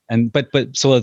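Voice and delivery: ordinary delivery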